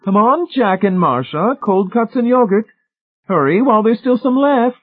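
high, loud call across the meadow. A narrator's reading. Mrs. Pritchett calling Jack and Marcia to lunch, high loud call. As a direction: sing out to them from far off. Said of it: "Missus Pritchett called".

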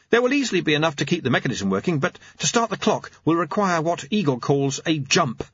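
high, quick clockmaker explaining; eager high-voiced inventor; Jeremy.

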